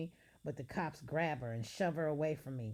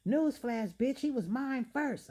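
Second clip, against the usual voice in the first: high, loud catfight yell; shrieking threat; 9 semitones higher, 7 dB louder than usual. Acted. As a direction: scream it at the rival. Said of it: "I yell".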